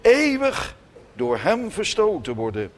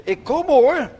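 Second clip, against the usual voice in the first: higher and louder, reassuring call; parent calling back; 10 semitones higher, 7 dB louder than usual. Retold or imitated. Parent answering a crying child from downstairs, calling reassuringly.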